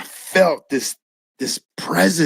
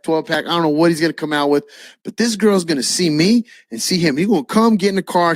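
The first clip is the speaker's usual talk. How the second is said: normal talking voice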